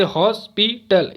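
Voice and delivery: "Hospital" slowly as हॉस, पी, टल; three separate beats on "hospital"; syllable by syllable. Shown as a demonstration. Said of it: "इसमें भी तीन syllable है". ("Hospital" को तीन टुकड़ों में धीरे)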